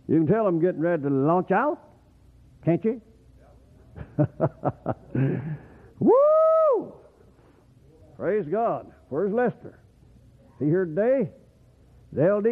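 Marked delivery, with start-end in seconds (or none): 6.0-6.9 very high, loud whoop of joy; preacher's whoop. Real, not acted. Preacher to his church, a loud joyful whoop. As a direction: let out a shouted woo.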